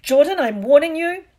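high, very loud teacher's threat; exasperated professor shouting a rebuke; stern warning. Acted. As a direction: warn them off, pitch climbing. McGonagall warns the commentator again.

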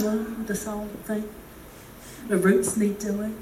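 slow, deflated brush-off; Debbie Downer. Acted. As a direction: sag into a glum, self-deprecating reply. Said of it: "Everything's Debbie Downer".

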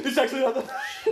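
high, loud echo through laughter; mock defensive. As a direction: mimic a squeaky, laughing protest.

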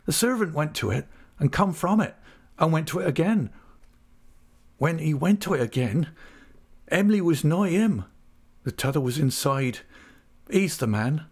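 distressed countryman's account; distressed Ham. Acted. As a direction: break the bad news in country dialect, pitched high. Narrator voices Ham.

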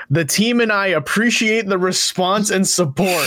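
whole sentence pushed up high, emphatic; read as bold text. Retold or imitated. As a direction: read it like it's printed in big bold letters. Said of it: "in big, bold letters".

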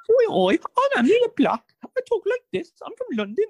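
very high, loud mock London accent; bad British accent. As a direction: squeal it in a terrible fake Cockney. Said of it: "get better at her British accent".

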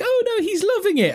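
very high squeaky cat-owner voice; mock cat owner. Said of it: "cat owners where they're like".

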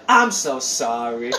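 lightly voiced woozy apology, low and slow; drugged kid. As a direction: imitate a doped-up kid dragging out an apology. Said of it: "He's like".

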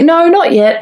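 amused, giggly retelling; laughing imitation. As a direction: imitate the friend's blurted answer, giggling. Retelling friend's blunder, amused.

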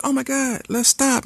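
put-on high, breathless girlfriend gushing; giddy high girly voice. Voicing the girlfriend on the hike.

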